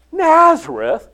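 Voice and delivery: can't believe it's Nazareth; scoffing echo, pitch jumping up; incredulous. Acted.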